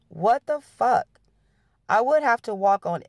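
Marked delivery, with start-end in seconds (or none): 0.1-1.2 slow, higher mock yell; a read-aloud yell, pitched up; angry line yelled out slowly. A narrator's reading. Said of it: "Sean yelled".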